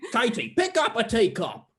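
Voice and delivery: mid-pitched puppet giving an order; insistent puppet voice. Acted. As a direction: put on a cartoon voice and press the demand. Puppet repeats order to Katie.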